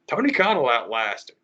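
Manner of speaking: ordinary delivery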